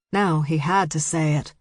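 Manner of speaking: ordinary delivery, nothing marked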